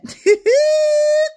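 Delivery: high, loud shrieking cackle; helpless high laugh at herself. Real, not acted. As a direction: burst into a squealing laugh. Laughing at her costume.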